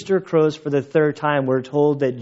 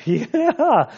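mock high yeah; 12 semitones higher than usual. Retelling laughing reply at deathbed.

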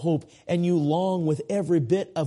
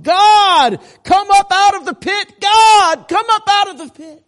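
full-force, high-pitched desperate shout; crying out; 14 dB louder than usual. Acted. Despairing man shouting down into a hole, crying out. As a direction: cry out to God at the top of your voice. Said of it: "he's crying out".

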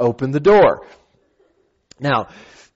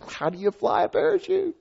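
high, soft incredulous voice; bewildered question; 7 dB quieter than usual. Retold or imitated. Skydiver to instructor about steering, high bewildered question. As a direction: squeak it out, baffled.

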